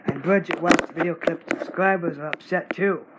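ordinary delivery

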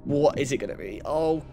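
normal talking voice

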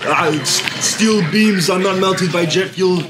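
low mock action-hero voice; Arnold Schwarzenegger. Hero joking after each kill, low action-star impression. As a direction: lumber through it like a movie tough guy. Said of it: "funny things he says each time he kills something".

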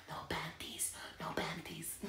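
playful whisper. Real, not acted. Whispering a joke to her viewers. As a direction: breathe it out like a naughty secret.

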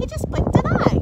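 put-on very high, loud child's voice; boy crying out. A narrator's reading. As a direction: exclaim excitedly as a little boy. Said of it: "cried the boy".

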